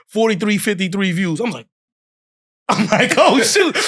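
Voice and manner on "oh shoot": high, loud retold reaction with a laugh; excited amazement. Seeing his view count jump, high laughing amazement. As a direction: relive the happy shock.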